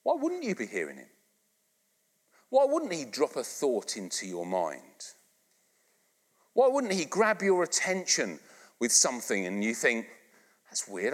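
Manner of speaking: ordinary delivery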